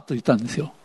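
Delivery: ordinary talk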